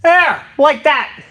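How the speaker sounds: high, slow, squeaky cartoon-mouse pitch; Mickey Mouse. Real, not acted. Streamer showing the weapon to chat, accidentally in a high cartoon-mouse voice. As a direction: let the voice jump way up and stretch it out. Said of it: "I sounded like Mickey Mouse".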